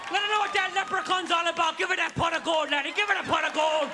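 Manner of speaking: Irish ancestors cheering. Retold or imitated. Irish ancestors cheering on their descendant, in an Irish brogue. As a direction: egg him on in a lilting Irish accent.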